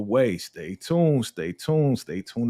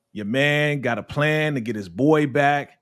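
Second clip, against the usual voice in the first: ordinary delivery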